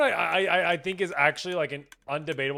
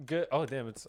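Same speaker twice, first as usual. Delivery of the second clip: ordinary delivery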